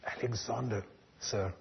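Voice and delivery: soft, timid answer; a scared young soldier, barely audible; timid reply. A narrator's reading.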